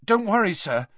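quickly reassure, worried; anxious boy comforting his headmaster. A narrator's reading. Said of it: "said Harry at once".

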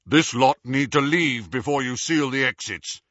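firm official order in an assumed voice; authoritative. A narrator's reading. Harry as Runcorn to the wizards, authoritative. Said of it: "with all the authority he could muster".

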